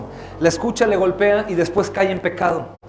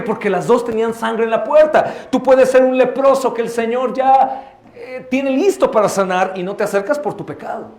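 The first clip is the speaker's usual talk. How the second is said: ordinary delivery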